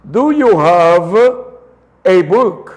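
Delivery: slow, careful English question; slow demo. Shown as a demonstration. Teacher modelling the question slowly for students. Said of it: "Chequen cómo va el orden de la pronunciación".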